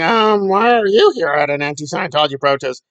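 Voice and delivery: mock badgering. Imitating Lara badgering Daniel.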